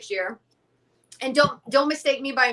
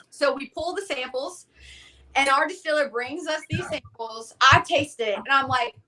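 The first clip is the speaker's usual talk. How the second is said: ordinary delivery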